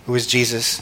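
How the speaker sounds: ordinary talk, nothing marked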